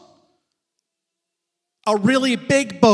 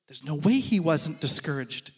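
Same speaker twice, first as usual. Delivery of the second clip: lower, softer, drawn back; hushed refrain; 10 semitones lower, 8 dB quieter than usual. Real, not acted. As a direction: let the refrain sink to a hush. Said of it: "refrain dropped low and soft".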